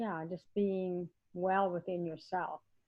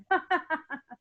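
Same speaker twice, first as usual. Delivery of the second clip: quick falling laugh; short laugh; 10 semitones higher, 6 dB louder than usual. Real, not acted. Laughing at own hiccups.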